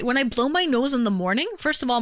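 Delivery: ordinary delivery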